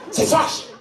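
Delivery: "fâche" said high, German accent, harsh and clipped; German-accented French. Shown as a demonstration. Mocking a German-style pronunciation, "très, très fâche" with German accent. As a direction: say "fâche" the way a German would pronounce it. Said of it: "tu la prononces un peu à l'allemande".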